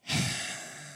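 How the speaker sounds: imitating wife's exasperated sigh; heavy sigh. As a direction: let out one long, audible sigh.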